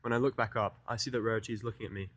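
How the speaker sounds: ordinary delivery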